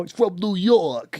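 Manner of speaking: lightly put-on New York accent, drawn out. Viewer says he's from New York, mock New York accent. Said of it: "he doesn't really sound too much like it".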